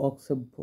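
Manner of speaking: অক্ষেব্য with an s after the k; correct pronunciation. Shown as a demonstration. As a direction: say অক্ষেব্য with a clear "ks", as "oksebbho". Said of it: "এই উচ্চারণগুলো".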